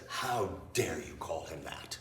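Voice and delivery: low, slow, hushed mock outrage; soft, measured reproach, pitched well down; retold indignation. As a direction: imitate an affronted man, quietly and deliberately. Retold outraged man confronting Rachel.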